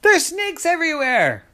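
high-pitched horrified yelp; panicked cry. Acted. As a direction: cry out in comic terror. Daddy screams at snakes.

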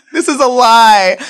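high, loud laughing aside; loud amused denial; laughing loudly. Real, not acted. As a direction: blurt it out through a laugh.

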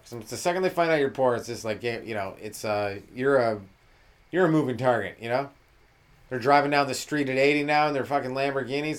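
normal talking voice

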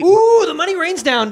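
gloating. Acted. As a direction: relish the money raining down. Gloating about money.

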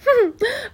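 short high closed-mouth laugh; self-amused chuckle. A narrator's reading. Matron amused by her own quip, short chuckle. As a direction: chuckle at your own joke. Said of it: "laughing rather hoarsely at herself".

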